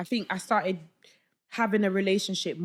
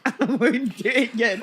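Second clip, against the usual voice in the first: laughing mock promise; 3 semitones higher, 4 dB louder than usual. Repeating a promise to God, laughing. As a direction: repeat the vow through giggles.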